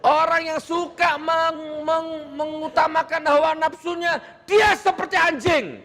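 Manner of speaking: high-pitched forceful preaching shout; emphatic harsh intonation. Shown as a demonstration. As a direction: drive every phrase home, high and hard. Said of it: "Itu kan intonasi". (berseru keras dengan nada tinggi)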